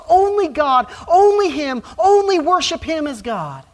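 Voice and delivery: "only" punched high and loud each time; each "only" hit hard and high; emphatic only. Shown as a demonstration. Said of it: "is front-loaded. It's emphatic".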